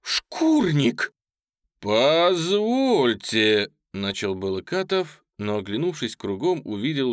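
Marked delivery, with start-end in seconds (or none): 0.0-1.1 very high, loud, furious; indignant high-pitched outburst; shouted outrage. A narrator's reading. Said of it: "крикнула Оля". 1.8-3.7 loud, very drawn-out objection; slow, raised, affronted cry; indignant protest. Acted. Insulted officer begins to protest.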